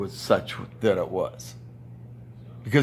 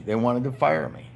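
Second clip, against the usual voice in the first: ordinary delivery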